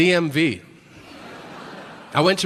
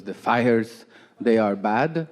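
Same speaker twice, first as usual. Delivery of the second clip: put-on low-pitched Peruvian-accented mom; mother's Peruvian accent; 4 semitones lower, slower than usual. Mom phoning her son, in a Peruvian accent. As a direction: imitate a Peruvian mother's English. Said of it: "she's from South America".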